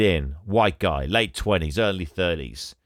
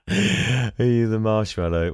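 amused repeat; slower than usual. Real, not acted. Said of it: "That's just what a sentence that is".

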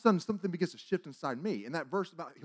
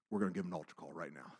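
low, soft, quick statement; hushed resolve; 12 semitones lower, 12 dB quieter, faster than usual. Retold or imitated. Teller moved by a weeping boy, hushed resolve. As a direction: say it quietly, almost to yourself.

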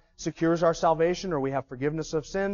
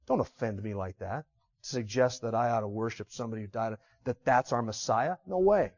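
much lower and softer, disgusted; 7 semitones lower, 6 dB quieter than usual. Retold or imitated. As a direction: mutter low in offended disbelief.

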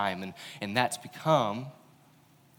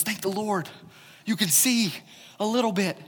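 relieved Jesus; 11 semitones higher, 4 dB louder than usual. Retold or imitated. Jesus relieved someone finally gets it.

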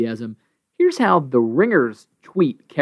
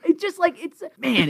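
mad; 13 semitones higher than usual. Real, not acted. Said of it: "it makes me mad".

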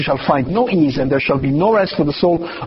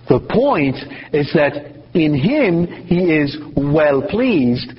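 usual speaking voice

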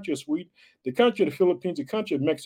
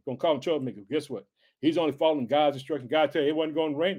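ordinary talk, nothing marked

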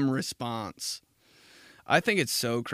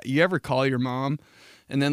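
ordinary talk, nothing marked

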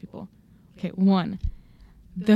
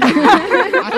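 laughing; 12 semitones higher, 10 dB louder than usual. Real, not acted. Laughing at friend's story.

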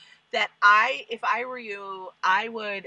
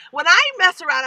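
ordinary talk

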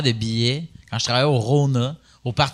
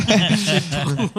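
says it through a laugh; 5 semitones higher than usual. Real, not acted. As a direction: laugh while repeating it. Répète la réplique en riant. (en riant)

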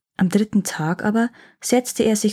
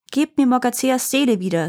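higher, controlled demand; unmoved; 4 semitones higher than usual. A narrator's reading. Boy demands the soul from trapped elves, unmoved. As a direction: demand it without a trace of pity. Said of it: "sagte er ungerührt".

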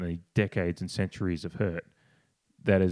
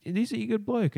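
much higher, insistent mock question; Rowie. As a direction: imitate someone pressing the same point. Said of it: "And Rowie again went".

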